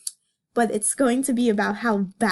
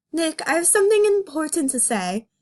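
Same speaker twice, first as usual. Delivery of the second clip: higher, pushy wake-up call to Nick; bunny cop shaking her partner awake; voicing Judy; 6 semitones higher than usual.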